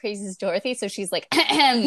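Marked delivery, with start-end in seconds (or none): 1.3-1.8 loud, raised mock throat-clear; exaggerated attention-seeking "ahem"; pointed ahem. Imitates Dorothy's pointed throat clearing.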